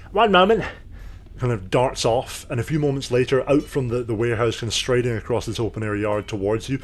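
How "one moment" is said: much higher, louder little gnome voice. Acted. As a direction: pipe up high and loud as a tiny gnome. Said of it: "the gnome kind of nods and goes".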